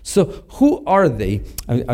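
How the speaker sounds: ordinary delivery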